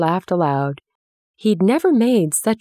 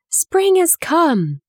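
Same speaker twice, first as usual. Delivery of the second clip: excited announcement; 11 semitones higher, slower than usual. A narrator's reading. Mary bursts in with news.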